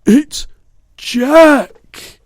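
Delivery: very high, loud bellow; panicked bellow. A narrator's reading. Giant bellows on seeing Jack in his nightmare, high bellow. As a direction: bellow it out in terror. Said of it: "bellowed the flesh lump eater".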